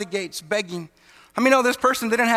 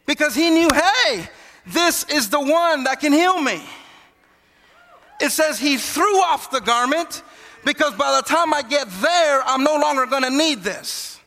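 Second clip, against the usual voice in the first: raised, higher-pitched excited conviction; fervent preaching; 5 semitones higher, 3 dB louder than usual. Acted. Preacher voices blind man sure of healing, fervent and raised. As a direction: build to a preacher's peak and hold it up high.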